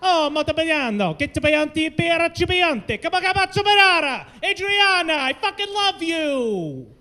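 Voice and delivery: high, hammed-up mock Italian accent; over-the-top Italian character voice; Luigi. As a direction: wail it in a cartoon Italian accent, stretching the endings. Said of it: "See, that's Luigi".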